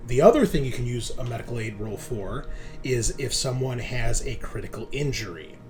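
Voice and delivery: ordinary delivery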